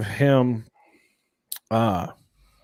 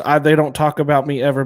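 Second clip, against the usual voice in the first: normal talking voice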